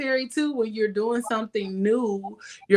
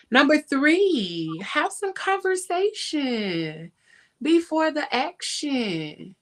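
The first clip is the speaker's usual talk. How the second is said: slow, higher-pitched proclamation; drawn-out announcement; 5 semitones higher, slower than usual. Real, not acted. Host announces tip number three to listeners, drawn-out. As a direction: stretch out each word like a headline.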